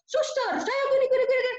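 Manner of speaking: high, loud patient from Medan. Retold or imitated. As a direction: imitate a blunt, loud patient. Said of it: "ngomongnya keras banget".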